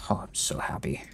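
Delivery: breathy whispered delight; happy whisper. Real, not acted. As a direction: hush it like a thrilled secret. Delighted over loot, whispered.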